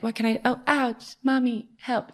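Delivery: put-on high, whiny little-kid plea; needy child voice. Big sister mocking her younger self as a needy child. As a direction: play the helpless child calling for mommy.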